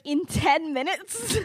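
high, loud, drawn-out rising question; mock incredulity. Kyra hearing the ten-minute deadline, mock disbelief. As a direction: stretch it into a hammed-up question.

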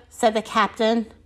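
ordinary delivery

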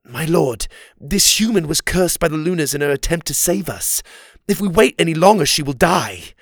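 put-on pleading young guard; urgent plea. A narrator's reading. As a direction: press the case urgently to your lord. Oliver urgently begs king for help.

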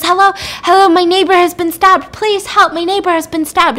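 high, loud, urgent plea; panicked caller. Retold or imitated. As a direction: act out a frantic emergency call. Retold panicked emergency call.

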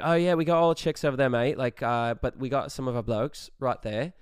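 loud, quick mock Australian; Australian accent. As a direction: ham up an Australian bloke. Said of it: "the accent".

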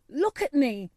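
loud, high, defiant cry; flung out. A narrator's reading. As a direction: fling it up at the old man. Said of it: "he flung up at Ben".

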